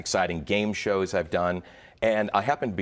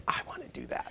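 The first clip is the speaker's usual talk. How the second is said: awed whisper; 10 dB quieter, faster than usual. Retold or imitated. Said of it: "I said".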